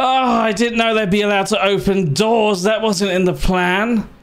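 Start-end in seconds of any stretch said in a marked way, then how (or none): none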